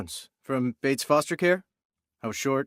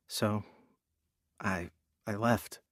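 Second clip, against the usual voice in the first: quiet confession; 6 semitones lower, 8 dB quieter than usual. Acted. Admitting a bad adoptive family.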